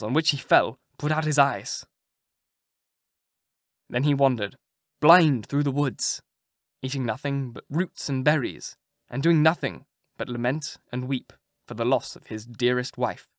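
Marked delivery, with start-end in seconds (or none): none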